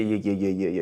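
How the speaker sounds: ordinary delivery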